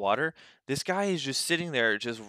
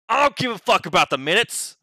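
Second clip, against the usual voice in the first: loud higher angry yell; 7 semitones higher, 6 dB louder than usual. Retold or imitated. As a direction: bellow it at the guy. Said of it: "That's what he yells at Juan".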